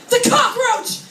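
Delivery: high, panicked exclamation; mock panic. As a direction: cry out at a sudden bug sighting. Acting discovery of a cockroach.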